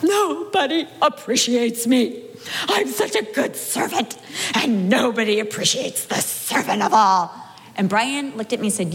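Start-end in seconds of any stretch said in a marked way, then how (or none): 0.0-7.3 slow, sobbing self-pity, half laughing; mock wailing. Wife complaining to husband Brian, mock wailing. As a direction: wail it like a martyr, hammed-up. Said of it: "That made me wail all the harder".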